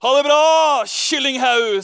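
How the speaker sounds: loud, cheeky farewell tossed back; loud mocking goodbye. Young eagle calls back to the hens, loud and cheeky. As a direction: shout a taunting goodbye over the shoulder. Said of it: "sier han".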